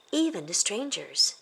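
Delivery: usual speaking voice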